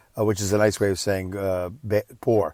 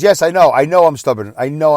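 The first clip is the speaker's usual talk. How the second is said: loud, higher, insistent retort; stubborn admission; 5 semitones higher, 8 dB louder than usual. Retold or imitated. Father answering son who called him stubborn, loud and insistent. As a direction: cut in, impatiently owning up. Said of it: "you're being so stubborn".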